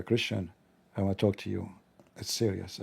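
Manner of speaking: low, quiet, grave confiding voice; a hint of a gloomy naive man; solemn admin.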